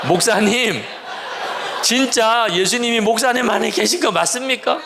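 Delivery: high-pitched mock yell at the pastor; strained, high demand, playfully imitated; imagined congregants' outcry. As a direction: act out church members protesting, pitch way up. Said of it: "이렇게 소리 지르겠구나".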